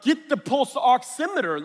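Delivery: high, loud, insistent order; exasperated urgency. Retold or imitated. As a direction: demand it as patience runs out. Urgent quarrel with wife on phone.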